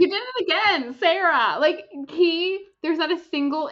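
high and loud, bursting with delight; excited squeal. Real, not acted. Fan gushing to her cohost about the wings, excited and loud. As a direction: gush about it at full volume. Fangirling over the romantic gesture.

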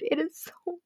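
high, slow, swooning gush; gushing squeal. Real, not acted. Gushing to viewers about the fic, high and swooning. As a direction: melt over how good it is.

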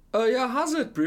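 high, lively South African greeting; imitating the instructor's greeting. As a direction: toss off a chummy how's it, bro. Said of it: "And he says".

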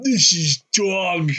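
hammed-up disgusted groan of words; mock disgust. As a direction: stretch it out in exaggerated disgust. Acts out their own disgusted verdict.

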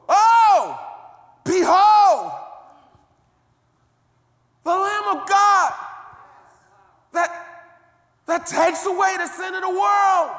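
high, full-throated shout of discovery; John's great cry. Shown as a demonstration. Preacher shows the church how John cried out. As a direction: cry it out as if struck by revelation. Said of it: "let me show you how he said it".

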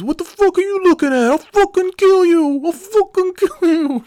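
hammed-up high, loud screech; raging threat. Stranger banging on the shop door, screeching threats. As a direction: imitate a furious shrieking madman.

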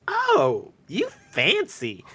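high, drawn-out sitcom sass; sassy neighbor character. Retold or imitated. Geraldine reacting to the new ice maker, sassy neighbor character. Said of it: "goes right back to the character".

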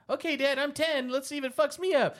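put-on high-pitched eager kid voice; ten-year-old self. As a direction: imitate your younger self talking to dad. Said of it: "when I was young".

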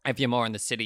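normal talking voice

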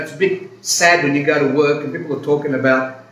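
ordinary talk

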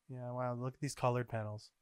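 soft, subdued reaction; understated wow, softly spoken. Real, not acted. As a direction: remark on it quietly, almost to yourself. First, subdued reaction to pictures.

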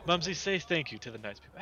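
acted doting prompt; prompting parent figure. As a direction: coax a little one to say thanks.

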